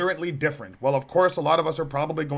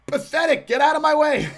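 higher, louder scoff with a laugh; dismissive retort; 9 semitones higher, 6 dB louder than usual. Retold or imitated. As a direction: brush them off, laughing. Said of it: "Just say".

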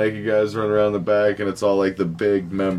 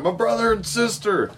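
higher mock voice for Kenny; 10 semitones higher than usual. Kenny telling the blackmailers who is in the car, pitched up. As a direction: play Kenny's answer up high, half-acted.

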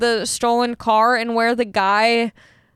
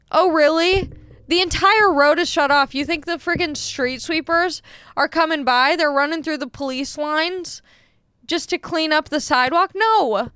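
higher-pitched incredulous retort, retold; angry sarcasm; 4 semitones higher than usual. Driver to neighbour about street sweeping, retold angry sarcasm. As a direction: argue back, scoffing at the idea. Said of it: "it made me so angry".

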